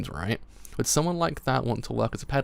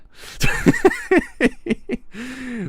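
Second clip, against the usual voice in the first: high, loud giggling; real high-pitched burst of laughter; real laughing fit; 7 dB louder than usual. Real, not acted. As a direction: crack up helplessly. Cracks up at own reading.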